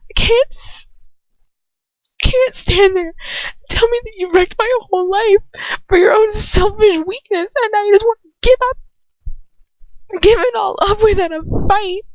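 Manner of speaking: high, crying plea; tearful girl's voice. A narrator's reading. Girl accusing her lover, high and tearful. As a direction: voice breaking into tears. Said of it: "I barely hear my own voice".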